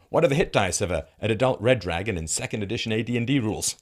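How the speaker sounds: usual speaking voice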